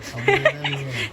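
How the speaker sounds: breathy little laugh under the breath; soft unvoiced giggle. Real, not acted. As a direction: giggle quietly at the silent fight.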